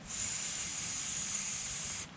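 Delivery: long hissing "S" through teeth; "S" as a steady hiss, teeth together; hissed s sound. Shown as a demonstration. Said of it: "put your teeth together and your tongue just behind".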